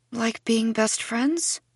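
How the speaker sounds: "friends" lifted high as a question. A narrator's reading. Girl asking Stacy about the secret, questioning tone. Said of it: "I said it like a question".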